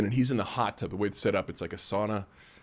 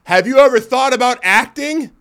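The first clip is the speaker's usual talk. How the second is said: much higher and much louder, a gushing stranger's voice; eager stranger impression; 12 semitones higher, 12 dB louder than usual. Stranger in the hot tub pitches acting to him, high and loud. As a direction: blurt the question at him like an overexcited fan. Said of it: "And he's like".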